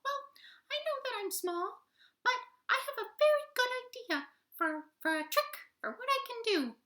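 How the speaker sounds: very high squeaky polite voice; tiny toad voice. Acted. Tree Toad interrupting the animals, very high squeaky voice.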